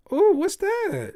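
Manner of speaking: very high, loud, slow cooing; fake-delighted parent. Pretend parent cooing at a child's drawing, very high. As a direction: gush over a kid's drawing.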